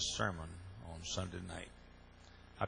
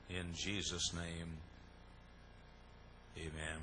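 slow closing prayer; 4 semitones lower, 9 dB quieter, slower than usual. Acted.

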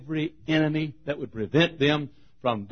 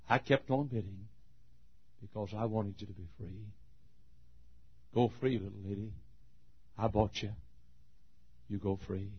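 soft, tender reassurance; gentle, kind; 7 semitones lower, 14 dB quieter, faster than usual. Acted. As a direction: soothe her gently. Kind man frees the girl.

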